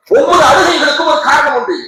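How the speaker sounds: ஒவ்வொரு hit high and loud; preacher raising his voice on the repeat; emphatic shout. Shown as a demonstration. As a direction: hammer the line home to the crowd. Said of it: "repeated louder".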